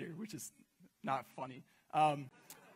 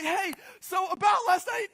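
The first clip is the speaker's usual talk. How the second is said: nervous opener; 12 semitones higher, 9 dB louder than usual. Retold or imitated. Nervous opener at her house.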